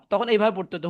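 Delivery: ordinary delivery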